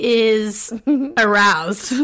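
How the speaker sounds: slow, breaking into laughter; laughing. Real, not acted. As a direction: laugh through the word aroused. Laughing mid-sentence.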